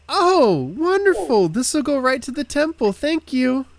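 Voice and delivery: very high, loud, gushing thanks; squealing over-pleasant local; delighted townsperson. Acted. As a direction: overflow with grateful joy. GM acts townsperson receiving silver.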